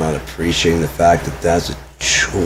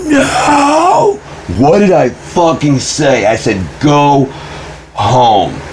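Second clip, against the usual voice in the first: high-pitched, drawn-out furious yell; retold scream; 9 semitones higher, much slower than usual. Son yelling at mom through the bathroom door, retold scream. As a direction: re-enact screaming through a shut door, voice shooting up. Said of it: "I screamed".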